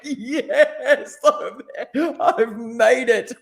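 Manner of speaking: very high, laughing celebration; elated dad's over-the-top cheer through laughter; mock triumphant. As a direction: squeal it slowly like a huge victory. Father retells his triumph, laughing.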